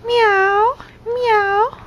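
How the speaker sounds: high, sing-song "meow, meow" rising at the end; high cooing kitty demo. Shown as a demonstration. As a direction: play a cute little kitten for the baby. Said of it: "what does a kitty say? Kitty says".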